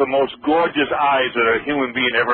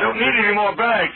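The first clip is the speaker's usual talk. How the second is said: high-pitched plea; 8 semitones higher than usual. Retold or imitated. Acting out pleading with fans.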